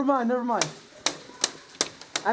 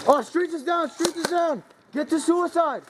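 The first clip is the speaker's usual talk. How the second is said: urgent callout; 6 semitones higher, slower than usual. Real, not acted.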